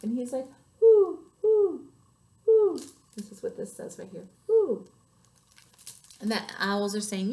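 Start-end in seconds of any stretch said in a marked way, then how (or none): 0.8-2.8 high, loud owl hoots. A narrator's reading. Storyteller shows how the owl hooted, high hoot. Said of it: "he just hooted like an owl". 4.5-4.9 one high, loud hoot; owl hoot. A narrator's reading. Storyteller reads the owl's cry, high hoot. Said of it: "he just hooted like an owl".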